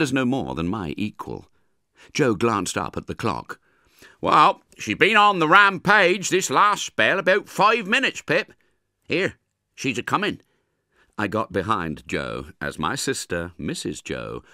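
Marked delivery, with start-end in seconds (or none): 4.2-10.4 higher, louder, rustic blacksmith voice; Joe warning Pip. Acted. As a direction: warn the boy she is on the rampage.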